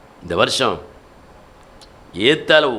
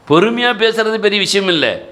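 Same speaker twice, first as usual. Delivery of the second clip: ordinary talk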